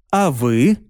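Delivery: usual speaking voice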